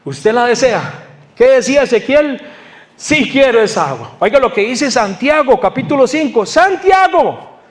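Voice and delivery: high, emphatic sermon calls; rousing the congregation to listen; impassioned preaching. Acted.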